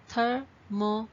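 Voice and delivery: "Thermometer" in four separate beats; clapped-out syllables. Shown as a demonstration. Teacher clapping with students, "Thermometer" split into syllables. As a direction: leave a clear gap after each syllable of "Thermometer". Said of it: "So let's clap and see".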